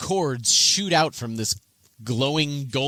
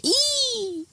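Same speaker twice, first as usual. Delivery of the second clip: high, squealed "Ee!"; pained goblin cry. Acted. Game master voices goblin grazed by a bolt, high pained squeal. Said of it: "and it cries out".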